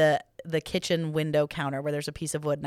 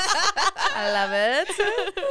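overly sweet, laughing gush; mock gush through laughter; 4 semitones higher than usual. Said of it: "I'm like".

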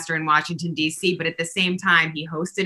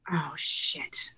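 breathy under-the-breath curse, much softer; whispered dread; 11 dB quieter than usual. Retold or imitated. Host recalling regret after hanging up, whispered. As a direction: let it slip out voiceless in sudden regret.

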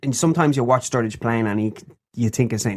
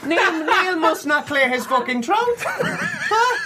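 laughing; slower than usual. Real, not acted. Giggling fit mid-podcast.

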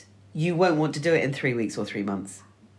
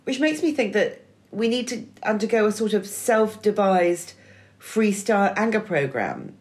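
ordinary talk, nothing marked

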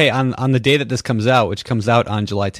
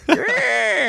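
high laugh. Real, not acted. Co-host laughs at voice.

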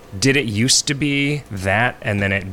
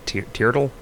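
ordinary talk, nothing marked

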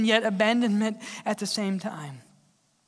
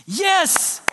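high, louder triumphant yes; victory shout; 8 semitones higher, 3 dB louder than usual. Acted. Preacher showing a shout of victory, high triumphant yes. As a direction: shout it like a win. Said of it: "Shouts are for victory".

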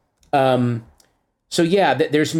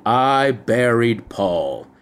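slow imitated mumble; 4 semitones lower, much slower than usual. Imitating the hidden Beatles line, slow and murmured. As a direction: stretch it out like a hidden message. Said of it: "everyone thought he was going".

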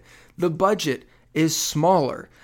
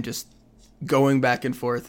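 usual speaking voice

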